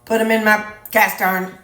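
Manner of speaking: ordinary talk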